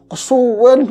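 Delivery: loud, high, drawn-out complaint; mock exasperated outburst. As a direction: grumble impatiently at being kept waiting.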